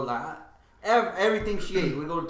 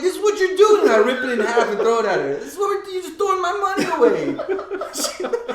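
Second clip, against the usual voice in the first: mock outrage; 10 semitones higher, 5 dB louder than usual. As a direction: drag it out in indignant laughter. Ripping bill, scolding.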